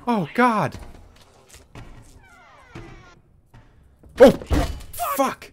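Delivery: loud panicked nopes; frantic and scared while running; being chased. Real, not acted. Continued fleeing in panic.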